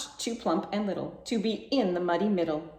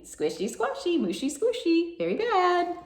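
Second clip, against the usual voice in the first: much higher, a squeaky piglet; high piggy voice; 11 semitones higher than usual. A narrator's reading. Piggy answering her family in a high squeaky voice. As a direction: squeak it out like a happy little pig. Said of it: "Said the piggy".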